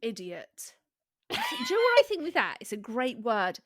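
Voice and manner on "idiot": ordinary talk, nothing marked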